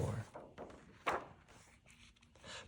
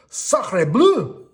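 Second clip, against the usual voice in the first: high, loud French candlestick cry; French exclamation; 10 semitones higher, 8 dB louder than usual. A narrator's reading. Lumiere spotting invaders from the window, in French alarm. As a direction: gasp it in a big French accent. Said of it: "cried Lumiere".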